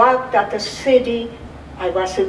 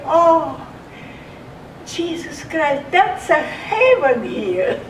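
raised, marvelling exclamation of relief; awed relief; 6 semitones higher than usual. Retold or imitated. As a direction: gasp it out like finally reaching heaven. Lying in a feather bed, grateful.

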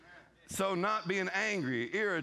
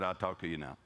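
much lower, calm voice of the Lord; voice of God; 8 semitones lower, 3 dB quieter than usual. Retold or imitated. The Lord answering the husband, much lower and calm. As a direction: speak low and settled as God. The Lord's retold voice.

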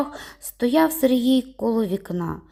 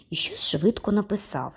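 usual speaking voice

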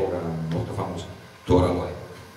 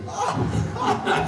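a burst of high airy laughter; high breathy laugh. Real, not acted. Interpreter laughs at the fried chicken answer, high breathy laugh.

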